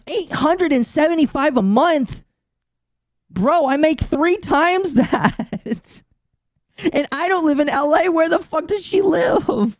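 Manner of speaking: high, slow mock disbelief; mocking incredulous voice. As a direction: parody her outrage, way up high. Mocking the actress's complaint.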